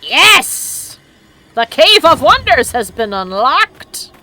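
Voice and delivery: loud high victory cry; triumphant. Real, not acted. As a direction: announce it in triumph. Triumphant announcement.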